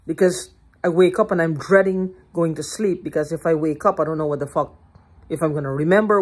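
usual speaking voice

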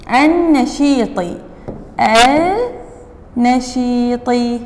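النشيطي slowly, silent lam and doubled nun; careful pronunciation. Shown as a demonstration. Teacher showing pupils the silent lam in النشيطي. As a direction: stretch النشيطي with the lam unsaid. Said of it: "هاي اللام هي مجرد تكتب ولا تلفظ".

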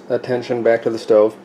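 ordinary delivery

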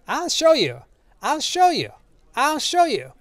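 very high, frantic repeated cry; shouting in character. A narrator's reading. Old man running down the gallery, high shouted cry. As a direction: pitch it far up, each cry alike. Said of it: "running down the gallery shouting".

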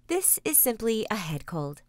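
ordinary delivery

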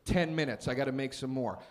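low, soft laid-back worker; KFC worker voice. Retold or imitated. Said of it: "He goes".

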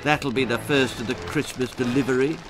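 usual speaking voice

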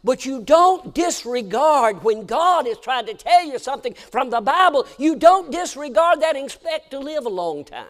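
loud, high preacher's warning; preaching at full force. Real, not acted. Preacher warning the congregation, loud and high. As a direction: thunder it from the pulpit.

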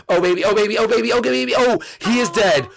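high, loud victory yells; triumphant shouting. Real, not acted. Gamer crowing over the friend he just caught, loud and high. Celebrating the kill.

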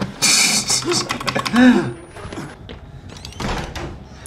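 high, soft laugh running out of breath; stifled laughter. Real, not acted. Streamer hears 'kill him', wheezy laugh.

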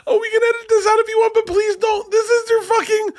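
high voice breaking into laughter; laughing while talking. Real, not acted.

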